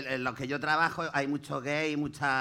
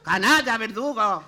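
acted insults; 7 semitones higher, 5 dB louder, slower than usual. Insulting fleeing conman.

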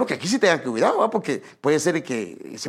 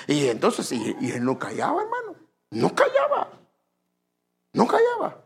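normal talking voice

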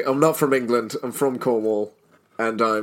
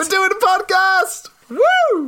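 high, squealing excited whoop; excited cheer; 3 dB louder, slower than usual. Real, not acted. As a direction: cheer it out wildly.

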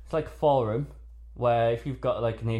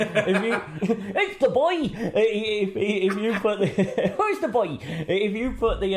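high laughing words, louder; laughing while talking; 9 semitones higher, 4 dB louder than usual. Real, not acted. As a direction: giggle through the sentence. Laughing through Mister Tumble bit.

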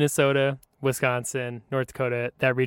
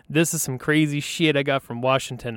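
normal talking voice

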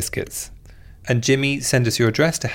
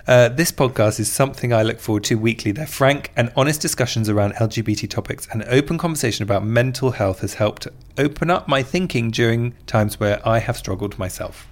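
normal talking voice

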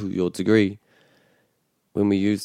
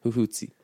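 soft "huhuti", middle hu stressed; stress on second syllable; 2 semitones higher, 5 dB quieter than usual. Shown as a demonstration. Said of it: "Other words like this".

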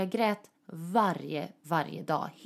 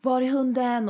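higher, louder demanding question; mock demand; 6 semitones higher, 5 dB louder than usual. Ex storming into her mother's home demanding the dog, mildly louder. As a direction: demand it like an angry ex. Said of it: "Och han var så här".

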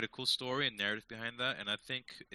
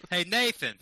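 put-on loud, high Boston accent; 7 semitones higher, 9 dB louder, slower than usual. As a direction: call out in a hammy Boston accent. Continuing the Boston impression.

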